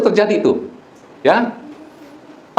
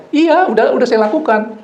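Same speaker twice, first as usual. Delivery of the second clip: maid's quick reply; 6 semitones higher than usual. Retold or imitated. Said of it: "Saya kasih ilustrasi begini".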